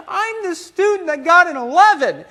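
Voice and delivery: high-pitched comic desperation; exasperated outburst. Retold or imitated. Student pleads with professor over failed exam, exasperated. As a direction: burst out in mock exasperation, pitch way up.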